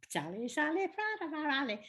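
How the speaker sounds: sing-song northern English voice; Lancashire impression. Imitating grandmother in broad Lancashire. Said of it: "my mother grew up imitating it".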